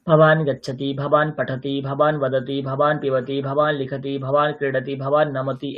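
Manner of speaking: steady, monotone chant-like rhythm; sentence-drill recitation. Shown as a demonstration. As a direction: recite each pair evenly on one pitch.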